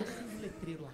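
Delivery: very soft, low instruction; hushed cue. Acted. Quietly telling the man to light the sign, hushed. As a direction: give the cue under your breath.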